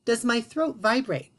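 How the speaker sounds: ordinary talk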